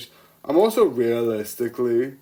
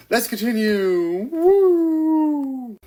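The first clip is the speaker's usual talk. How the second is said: cheer; 13 semitones higher, 4 dB louder, much slower than usual. Real, not acted. Cheering to continue.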